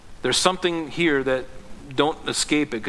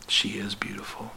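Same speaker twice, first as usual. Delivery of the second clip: soft hushed whisper, lower; gentle whisper; 5 semitones lower, 9 dB quieter than usual. Acted. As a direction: answer in a tender whisper. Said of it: "And the Father will go".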